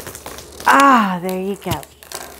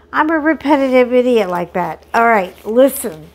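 normal talking voice

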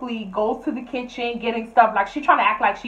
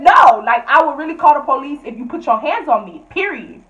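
louder, firm police threat; loud firm warning; 4 semitones higher, 7 dB louder than usual. Retold or imitated. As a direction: warn her hard and loud. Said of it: "So I said".

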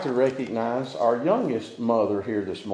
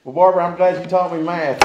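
normal talking voice

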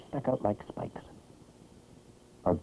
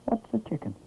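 poor little chick; 9 semitones higher, 3 dB quieter than usual. A narrator's reading. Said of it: "poor Peep said".

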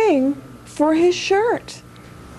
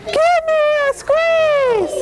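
high, slow sing-song; really high voice; 9 semitones higher, slower than usual. Shown as a demonstration. Teacher leading the kids' hug song, really high. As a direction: pitch it way up and stretch it. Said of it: "with a really high voice".

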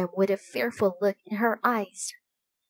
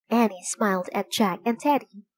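usual speaking voice

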